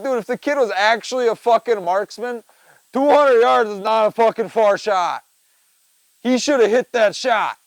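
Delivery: loud, high, exasperated insistence. Retold or imitated. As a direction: hammer the point home loudly. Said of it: "I'm like".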